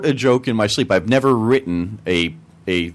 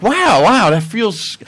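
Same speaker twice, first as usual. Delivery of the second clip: higher, louder burst of wonder; mock amazement; 9 semitones higher, 4 dB louder, much slower than usual. Recalling own reaction to a dreamt joke, amazed. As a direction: retell being wowed, voice leaping up.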